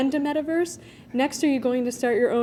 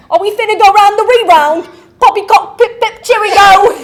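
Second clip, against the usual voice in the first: loud, higher hammed-up British accent; incoherent British accent; 8 semitones higher, 14 dB louder than usual. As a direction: butcher a posh British accent, laughing. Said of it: "Incoherent British".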